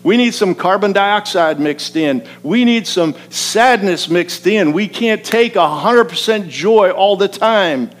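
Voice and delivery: high, loud, emphatic sermon delivery; full-force preaching. Real, not acted. Preacher telling congregation we need some sadness, fervent preaching. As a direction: drive the point home from the pulpit, loud and urgent.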